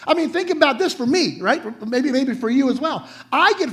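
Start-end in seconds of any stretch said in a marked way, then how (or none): none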